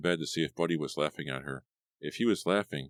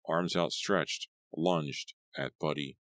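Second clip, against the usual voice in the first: ordinary delivery, nothing marked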